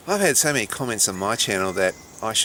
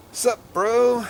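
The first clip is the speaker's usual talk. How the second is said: high, loud fake American accent; badly faked American bro greeting; 13 semitones higher, 5 dB louder than usual. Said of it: "I can't do an American accent".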